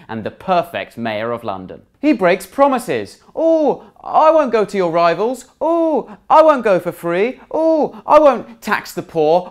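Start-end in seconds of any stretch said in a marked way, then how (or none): 3.4-9.5 high, loud whiny sing-song with big "Oh"s; hammed-up pleading impression; mock whiny Sol. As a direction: mimic a footballer's hollow promises, each "Oh" shot way up. Mocking Sol's whiny promises.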